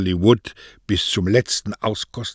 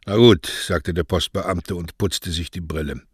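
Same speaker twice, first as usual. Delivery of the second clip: usual speaking voice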